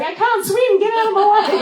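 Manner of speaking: put-on high, loud, laughing baby squeak; high squeaky baby voice through laughter; baby whale voice. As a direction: play the newborn whale bossing her away. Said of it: "He's probably just going".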